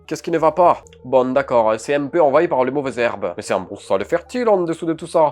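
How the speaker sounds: put-on the carpenter reassures him with a loud southern French accent. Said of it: "Je le fais bien l'accent du sud". (accent du sud, fort)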